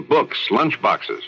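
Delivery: normal talking voice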